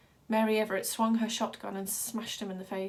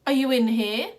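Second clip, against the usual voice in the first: louder, higher call into the dark van; 3 semitones higher, 10 dB louder than usual. A narrator's reading. Hooded man calling into the van for Wild Boy, loud and probing. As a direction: call out into the van to find him. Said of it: "he said".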